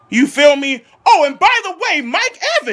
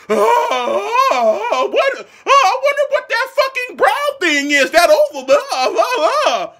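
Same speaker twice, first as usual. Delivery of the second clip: mock clueless player; 6 semitones higher than usual. Mocking clueless Eagles.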